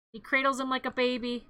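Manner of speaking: ordinary talk, nothing marked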